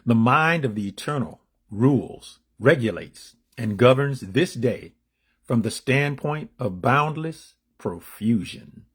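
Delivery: deliberate inspirational affirmation; read-aloud declamation. Acted. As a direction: proclaim the meditation with measured emphasis. Reads the daily meditation.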